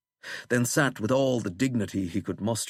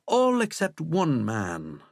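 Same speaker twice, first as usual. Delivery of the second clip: ordinary delivery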